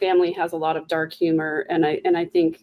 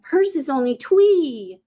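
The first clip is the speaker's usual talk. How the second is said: put-on slow, higher sing-song baby talk; 7 semitones higher, slower than usual. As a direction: coo it like talking to a toddler. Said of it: "this weird baby talk thing".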